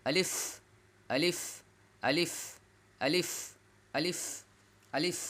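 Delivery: quick আলিফ, lips never meeting on the f; short, lips apart. Shown as a demonstration. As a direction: drill আলিফ short, with a rising tone and airy f. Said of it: "দুই ঠোঁট যদি না লাগে তাহলে উচ্চারণ হবে".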